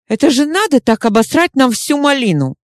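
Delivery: high, exasperated rant. Acted. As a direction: vent the frustration. Chuma ranting, exasperated.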